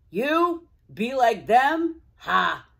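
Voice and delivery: put-on loud, scornful old streetcar jeering; a grumpy old man mocking a little car; gruff old-timer scoffing. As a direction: sneer it out like a scruffy old-timer. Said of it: "blurted out Old Mac".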